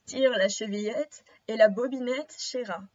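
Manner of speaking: lightly voiced low grandmother; wolf as Grandmother. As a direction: drop the pitch slightly, posing as Grandmother.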